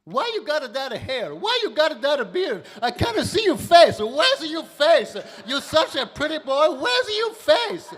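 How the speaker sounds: high, loud, broken-English grandma wail; Italian grandmother. Retold or imitated. Grandma scolding her bearded grandson, high and loud. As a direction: scold him like a doting old nonna. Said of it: "She goes".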